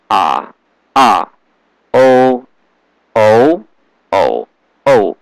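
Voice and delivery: ah, oh, uh, ee each through the first, second, third and fourth tone; four tones on each vowel. Shown as a demonstration. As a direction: model every vowel in all four Chinese tones, clearly. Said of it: "Sound like this".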